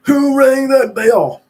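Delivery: a squeaky little gatekeeper calling out; grumpy porter. Acted. Porter at the window demanding who rang, high squeaky voice.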